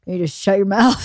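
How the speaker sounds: ordinary talk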